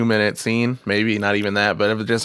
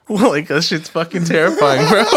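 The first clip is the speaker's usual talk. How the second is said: laughing high and loud; 8 semitones higher, 5 dB louder than usual. Real, not acted. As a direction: laugh through the words. Laughing at creepy whisper.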